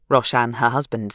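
plain reading voice

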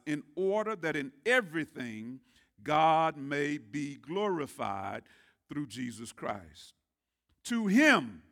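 measured, declamatory Bible reading; scripture reading. Real, not acted. Pastor reading First Peter aloud to church, declaiming. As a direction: read the Word with weight and swell.

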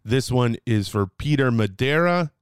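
ordinary delivery

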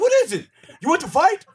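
very high mock aggressor; hammed-up high challenge; imitated confrontational challenge. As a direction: act out squaring up, pitch way up. Opponent's challenge acted out again.

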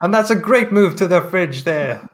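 sports commentator. Acted. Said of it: "commentate your life".

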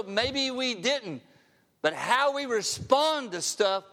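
ordinary talk

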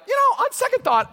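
leaps high and loud on second thought; a sly, offhand reversal; sudden change of mind. Retold or imitated. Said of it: "he's immediately like".

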